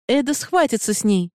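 high-pitched call over the din of battle; narrated battle cry, pitched high; urgent shouted order. A narrator's reading. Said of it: "крикнула Сабран".

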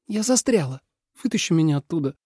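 high, slightly sulky plea; trapped heroine. A narrator's reading. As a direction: admit the predicament in a high voice. Trapped snake voiced higher.

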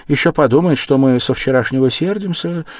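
a mother fussing that her son is offended; fretting mother. Acted.

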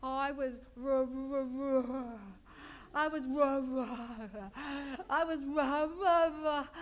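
stammering, stuck on the r; mock Fonzie choking on the word; a light Fonzie impression, can't get the word out; Fonzie unable to say wrong. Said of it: "He'd always go".

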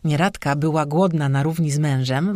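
ordinary delivery, nothing marked

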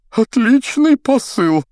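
very high, slow, loud compliment; showy praise. A narrator's reading. Fallen teacher praising his opponent, showy. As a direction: praise grandly, high and stretched out.

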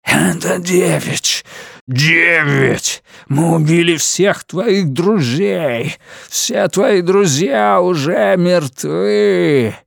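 an inhuman voice on the phone line; eldritch horror. A narrator's reading. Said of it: "гудящий, хриплый, нечеловеческий голос".

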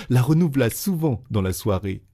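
ordinary delivery, nothing marked

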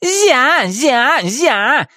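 high-pitched taunting repeats; mocking mimicry. A narrator's reading. Witch apes Нептолиум's plea, mockingly. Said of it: "передразнила его Матильда".

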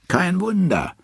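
high, jeering quip; mocking friends. A narrator's reading. Loki's drinking friends mocking Thor, in a high jeering voice. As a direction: sneer at the absent husband from way up high. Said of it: "sagen Lokis Freunde".

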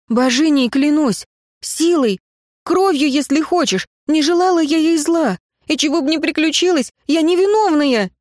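high, urgent oaths; desperate protest. A narrator's reading. Accused girl swearing to Ignat she is innocent, desperate. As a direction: plead innocence with pitch held high.

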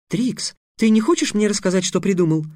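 indignant. Acted. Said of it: "возмутилась Тиана".

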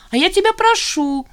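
high, loud insistent squeal. A narrator's reading. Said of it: "взвизгнула Зина".

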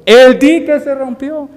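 loud, high, stretched-out warning shout; acted yell of alarm. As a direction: cry it out as if running through the streets. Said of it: "salió gritando por el pueblo".

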